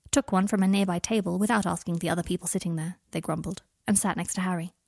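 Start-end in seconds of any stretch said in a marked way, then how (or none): none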